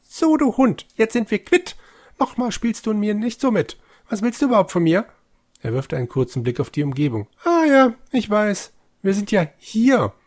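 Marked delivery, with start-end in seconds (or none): 0.0-5.1 high, loud angry character voice. Acted. Figur spricht im Spiel, Text vorgelesen. 7.4-10.1 character voice. Acted. Figur spricht weiter.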